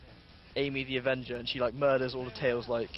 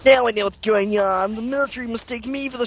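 Sonic voice; 10 semitones higher, 8 dB louder than usual. Acted. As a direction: play Sonic big and high. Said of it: "Sonic's a right knobber".

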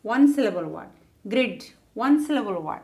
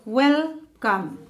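"Welcome" split as wel, pause, come; syllable by syllable; 2 semitones higher than usual. Shown as a demonstration. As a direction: break "Welcome" into two beats. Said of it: "two syllable word".

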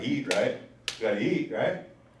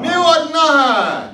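"Miwat naha" shouted slowly, high and loud; loud drill phrase; 13 semitones higher, 14 dB louder, slower than usual. Shown as a demonstration. Teacher leading a loud call-and-repeat, shouting slowly. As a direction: belt out "Miwat naha" for the class to echo. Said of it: "say it loud".

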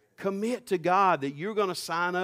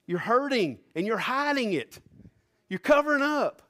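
rising preaching fervor; 8 semitones higher than usual. Real, not acted. Preacher urging a hurting church, climbing high and fervent.

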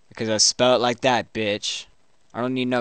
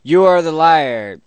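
high, loud retort; indignant accusation; 6 semitones higher, 11 dB louder than usual. Pretend. Player accusing the chatbot right back, loud indignation.

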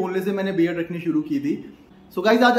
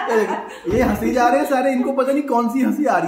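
laughing; 6 semitones higher, 4 dB louder than usual. Real, not acted. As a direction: still chuckling as he points. Family laughing at him.

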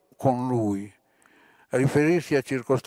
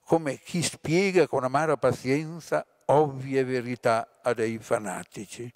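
ordinary delivery

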